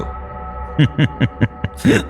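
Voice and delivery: drawn-out throaty snicker. Acted. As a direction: chuckle to yourself at his expense. Boss laughs mockingly at employee. (চাপা বিদ্রূপের হাসি)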